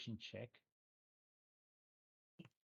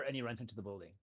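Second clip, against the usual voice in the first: usual speaking voice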